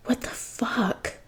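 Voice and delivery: drawn-out whisper of disbelief; slow stunned whisper. A narrator's reading. Jason repeating his shock to himself, slow whisper. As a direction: let each word hang, slow and airless.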